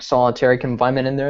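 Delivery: ordinary talk, nothing marked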